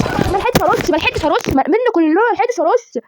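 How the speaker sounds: high, repeated wail of protest; frantic wail. Real, not acted. Player who missed the chance to spray, frantic wail. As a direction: wail in panic, pitched high.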